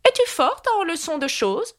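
high, questioning queen; examining Red Queen. A narrator's reading. Red Queen opening a new test, high examining voice. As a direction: quiz her in a high queenly voice.

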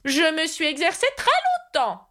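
high-pitched, earnest knight voice; extremely serious. A narrator's reading. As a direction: insist solemnly in a high character voice. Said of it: "d'un ton extrêmement sérieux". (chevalier très sérieux, voix haut perchée)

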